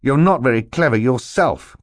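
loud, high fierce retort. A narrator's reading. Boy answering the villain who mocked him, fierce. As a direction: throw it back at him fiercely. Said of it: "Klaus said fiercely".

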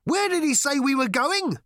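high-pitched shouted question; shouting in ear. A narrator's reading. Ford to Arthur on galloping beast, shouting. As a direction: shout to be heard over hooves. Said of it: "Ford shouted in Arthur's ear".

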